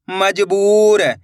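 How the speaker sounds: syllables joined into one slow word; blended word. Shown as a demonstration. Teacher joining the syllables for learners, slowly blended. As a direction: read it as one whole, stretched word. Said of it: "तो मिलके बन जाएगा".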